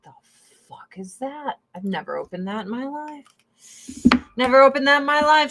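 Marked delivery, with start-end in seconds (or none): 4.4-5.5 higher, louder and fast; emphatic repeat. Real, not acted. Admitting to viewers she never used the eye balm, emphatic. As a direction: blurt it again with mock disbelief.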